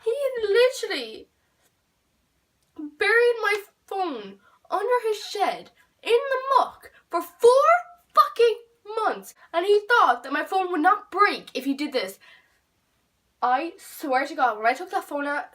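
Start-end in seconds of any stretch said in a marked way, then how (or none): 0.0-11.1 high, loud, incredulous; outraged. Real, not acted. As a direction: pitch it up in disbelief. Outraged retelling of the phone.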